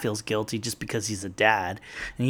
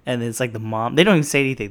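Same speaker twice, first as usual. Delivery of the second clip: ordinary delivery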